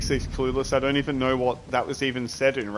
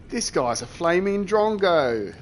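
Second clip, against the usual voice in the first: slow, higher mock Australian insult; 5 semitones higher, 5 dB louder than usual. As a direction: ham up an Aussie calling someone a drongo. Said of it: "Australian impression".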